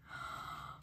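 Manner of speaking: soft breathy gasp of wonder; quiet intake of breath, amazed. Real, not acted. Collector discovers a new freebie, soft gasp.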